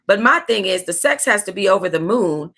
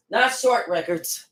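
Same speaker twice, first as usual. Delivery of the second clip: quieter, slow, not stressed; 5 dB quieter, slower than usual. Shown as a demonstration. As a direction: mutter the correction, leaning on not. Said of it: "Bitch, not Short Records".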